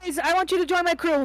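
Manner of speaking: loud eager call to the group. Acted. Player calling to the party, loud and eager. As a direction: shout the offer across the room.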